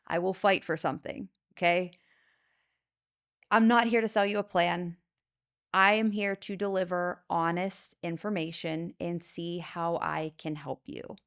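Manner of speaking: normal talking voice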